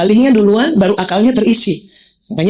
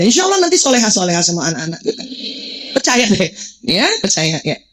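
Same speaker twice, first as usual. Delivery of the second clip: ordinary talk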